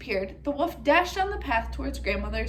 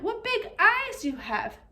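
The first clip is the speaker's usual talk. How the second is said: slow, high, wide-eyed amazement; slow wonder; 8 semitones higher than usual. Acted. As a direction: stretch it out in wonder. Girl marvels slowly.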